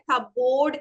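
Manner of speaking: "cupboard" said as cup-board, second half a full long "board"; "cupboard" with a drawn-out "-board"; common mispronunciation. Shown as a demonstration. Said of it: "അധിക ആൾക്കാരും നമ്മൾ പറയാ".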